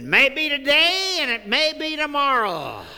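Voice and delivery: hammed-up doom preacher; ominous evangelist. Evangelist warns of judgment.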